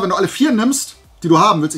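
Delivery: ordinary delivery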